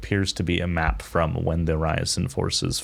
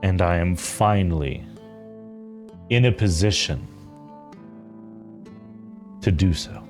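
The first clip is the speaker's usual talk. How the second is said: solemn mother figure; 6 dB quieter than usual. Acted. Game master as a determined mother to her child, solemn and measured. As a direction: confide a life's purpose with deliberate pauses.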